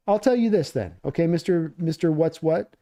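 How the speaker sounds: ordinary delivery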